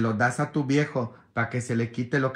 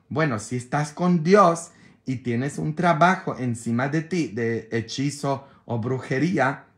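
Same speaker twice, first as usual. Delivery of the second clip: ordinary delivery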